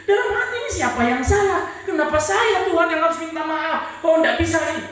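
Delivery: loud indignant complaint; indignant protest. Retold or imitated. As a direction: fling the protest at God. Inner protest against apologising.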